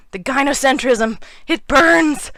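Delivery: loud, high hammed-up wail; mock agony. Reacting to Doctor Phil's quote, mock agony. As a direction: cry out as if scalded, in jest.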